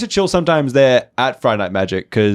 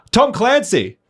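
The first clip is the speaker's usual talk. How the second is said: eager quiz answer; 13 semitones higher, much slower than usual. Retold or imitated. Friend shooting an arm up to answer first, eagerly. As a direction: pipe up high like a keen contestant.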